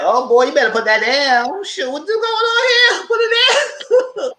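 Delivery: rising into a high, alarmed squeal; acted alarm. Host acting out Lisa Leroy telling him off, high-pitched alarm.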